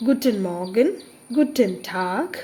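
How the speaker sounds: firm, emphatic German greetings; loud, stressed pronunciation. Shown as a demonstration. Teacher modelling greetings for learners, loud and well stressed. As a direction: stress each word and say it out clearly. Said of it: "കുറച്ച് ലൗഡായിട്ട് തന്നെ പറയണം".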